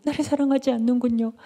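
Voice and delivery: high, whiny reproach; acted plaintive nagging; nagging refrain. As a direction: repeat it like a pestering lover. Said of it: "삼손을 맨날 따라다니면서".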